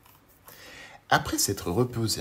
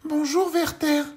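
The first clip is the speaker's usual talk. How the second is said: very high, louder little voice; tiny Monsieur Petit; 6 dB louder, slower than usual. Acted. Tiny man greets the worm, very high voice.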